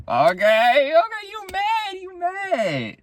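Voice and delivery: high, sing-song taunting voice; mocking. Narrator taunting the angry boy, high mocking sing-song. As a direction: tease him in a high mocking lilt. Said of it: "and I was like".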